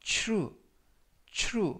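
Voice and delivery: true said with a ch-like tr; teacher showing the tr sound in true; tr pronunciation. Shown as a demonstration. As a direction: model the tr in true as ch. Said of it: "이때 티알 발음이".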